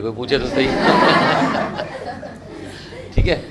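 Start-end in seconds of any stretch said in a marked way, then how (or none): 0.7-3.1 breathy high giggle; happy giggle at being asked. Real, not acted. Class laughs as teacher teases the student, high giggle. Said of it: "[name] बड़ी खुश हो रही है".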